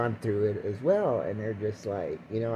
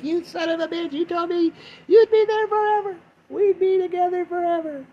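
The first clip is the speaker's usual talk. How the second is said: very high wailing ghost voice, cursing him; angry ghost; 4 dB louder than usual. Retold or imitated. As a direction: wail it like a betrayed ghost. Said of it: "Her ghost is like".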